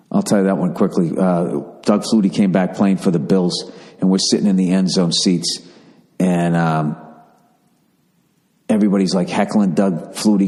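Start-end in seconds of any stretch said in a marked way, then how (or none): none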